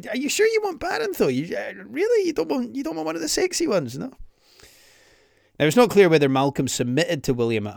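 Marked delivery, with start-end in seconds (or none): 0.1-4.1 fast, very high mocking Trump voice; a squeaky, rushed Trump sales pitch; Donald Trump. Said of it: "He'd just be like".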